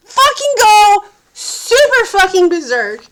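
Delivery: loud, high, half-laughing fury; berserk outburst. Real, not acted. Describing how close she is to snapping, loud and half-laughing. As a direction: let it almost snap.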